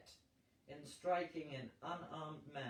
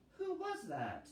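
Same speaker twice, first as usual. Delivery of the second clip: lady's voice; 10 semitones higher than usual. Acted. Woman asks after men leave.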